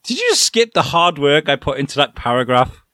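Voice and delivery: high, offended disbelief; indignant writer. Retold or imitated. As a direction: play the affronted writer. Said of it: "you could feel yourself getting side-eyed".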